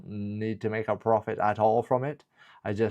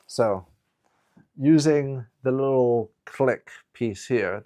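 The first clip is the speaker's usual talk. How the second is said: ordinary talk, nothing marked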